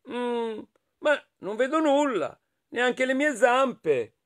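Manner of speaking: high little voice, slowing at the end; puzzled hedgehog. Acted. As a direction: voice a small creature lost in fog, pitched high. Hedgehog lost in fog. (vocina acuta e smarrita)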